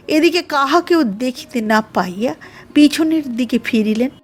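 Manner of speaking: ordinary delivery, nothing marked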